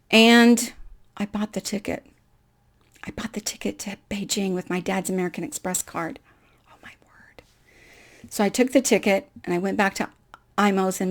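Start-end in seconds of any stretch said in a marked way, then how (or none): 1.2-2.0 quiet, guilty confession; hushed admission. Real, not acted. Confessing the forbidden card purchase. 3.0-7.4 whispered, guilty and awed; whisper, almost breathless; whispered confession. Real, not acted. Confessing use of dad's card.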